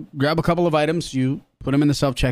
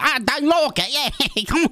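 high, over-the-top Cockney voice; cartoon Cockney; 14 semitones higher than usual. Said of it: "like a cartoon of a person".

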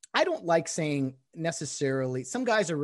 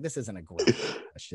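exasperated mutter; 6 semitones lower than usual. Retold or imitated. Inner eye-rolling reply.

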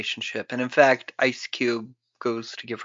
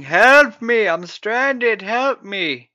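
high, loud stranded call; playacted distress call; mock cry for help; 8 semitones higher, 8 dB louder, much slower than usual. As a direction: call out for rescue, hammed up. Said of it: "they hear somebody going".

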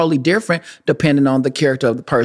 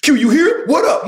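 raised, higher mock greeting; calling out; 8 semitones higher than usual. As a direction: hail a friend. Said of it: "I'd be like".

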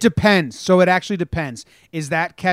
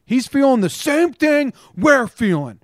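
defending shout; 10 semitones higher, 6 dB louder than usual. Retold or imitated. Defending teammate, continued.

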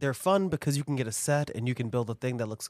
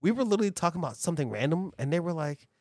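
usual speaking voice

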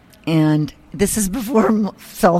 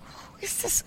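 whispered question; 12 dB quieter than usual. Retold or imitated. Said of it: "and I'm like".

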